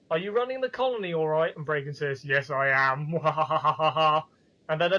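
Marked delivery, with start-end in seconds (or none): 0.1-1.5 quick, higher-pitched character voice; mock caller. Hensel calling Bragan to check on the colony, high mock voice. 2.2-3.1 lightly voiced gloating villain; mock villain's proud admission; hammed-up villain. Bragan boasting of running the colony, mock villain voice. 3.2-4.2 loud hammed-up villain cackle; rhythmic, stagey "mwahaha"; mock evil laugh. Bragan gloating over his power, loud mock evil laugh.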